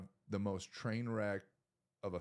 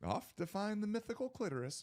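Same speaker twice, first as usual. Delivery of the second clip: movie quote, imitated; 11 semitones higher than usual. Joins in quoting the movie.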